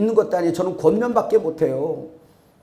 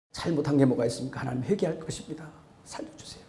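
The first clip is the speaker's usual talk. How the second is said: hushed prayer; 5 semitones lower, 10 dB quieter, faster than usual. Acted. Muttered private prayer.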